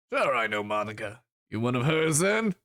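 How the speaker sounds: lightly voiced troll, questioning lift at the end; a touch of troll, sizing up the visitor; troll character. Troll asking about Monica, lightly voiced.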